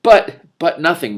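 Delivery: loud, slow firm refusal; stern cut-off. A narrator's reading. The king silences the prince, stern and loud. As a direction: shut the argument down.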